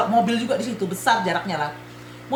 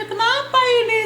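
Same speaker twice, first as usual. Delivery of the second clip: retold crying; 11 semitones higher than usual. Retelling how she cried out after the crash, mock wailing. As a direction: mimic a tearful cry of bewilderment, no real sobs. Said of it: "Langsung, langsung aku nangis aja".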